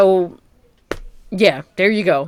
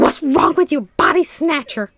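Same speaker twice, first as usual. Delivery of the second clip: lightly voiced, higher mock accusing tone; mock accusation; 7 semitones higher than usual. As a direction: toss it out as a playful, higher accusation. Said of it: "I was like".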